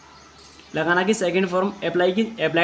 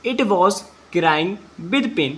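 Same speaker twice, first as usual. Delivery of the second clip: very slow English, each word apart; slow dictation; much slower than usual. Shown as a demonstration. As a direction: read it slowly so pupils can follow. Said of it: "अब यहाँ से पढ़ते हैं". (धीमी गति से शब्द-दर-शब्द पढ़ना)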